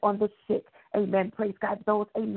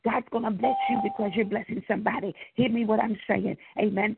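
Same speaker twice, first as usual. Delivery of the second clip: ordinary talk, nothing marked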